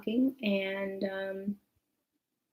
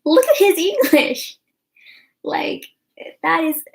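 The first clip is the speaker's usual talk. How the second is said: much higher and louder, marveling exclamation; amazed; 8 semitones higher, 8 dB louder than usual. Retold or imitated. To the mom about her son's drunk English, high loud amazement. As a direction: point it out in delighted disbelief.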